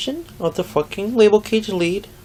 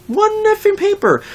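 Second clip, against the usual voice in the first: high, indignant outburst; exasperated; 14 semitones higher than usual. Real, not acted. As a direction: spit out the frustration. Venting about coworker.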